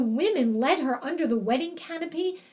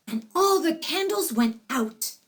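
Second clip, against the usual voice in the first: ordinary delivery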